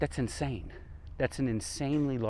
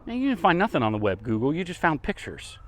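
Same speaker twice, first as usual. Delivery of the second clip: scolding the phone; 6 semitones higher, 3 dB louder than usual. Real, not acted. Scolds Google for bad answer.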